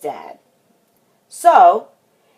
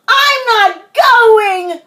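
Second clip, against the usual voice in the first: high, loud, very drawn-out protest; slow, high child's yell; crying out in refusal; 6 dB louder, much slower than usual. A narrator's reading. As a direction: dig in and wail every word. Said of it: "cried Froggy".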